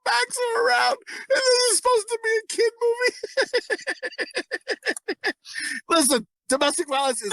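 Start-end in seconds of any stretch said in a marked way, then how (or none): 0.1-3.1 high laughing shout. Real, not acted. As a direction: yell it through laughter. Laughing while telling.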